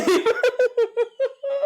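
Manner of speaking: long high-pitched peal of laughter. Real, not acted. Host cracking up over calling author Rosie, high laugh.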